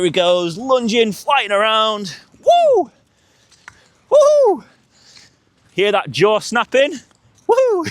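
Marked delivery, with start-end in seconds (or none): 2.4-4.6 high loud whoops; excited whoops. Real, not acted. Hyping the dog in play. 7.5-7.9 excited whoops. Real, not acted. Hyping the dog in play.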